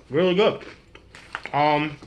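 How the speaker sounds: talking with mouth full; words muffled by food; chewing pizza. Real, not acted. Seen 3: Bites pizza, then chews with full mouth while speaking.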